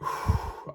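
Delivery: slow audible breath out; long exhale. Shown as a demonstration. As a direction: breathe out slowly through the mouth. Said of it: "So all you do is this".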